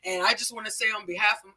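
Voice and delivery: a hint of an uncle addressing the camp; uncle's formal speech.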